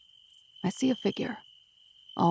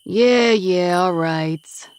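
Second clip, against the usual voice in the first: loud, weary East Coast yeah, yeah; thick East Coast accent, resigned; 7 dB louder, much slower than usual. Acted. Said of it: "in his thick East Coast accent".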